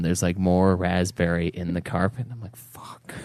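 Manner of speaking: whispered dismay; defeated whisper. Retold or imitated. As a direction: mutter it under your breath, beaten. Dismay at stained carpet.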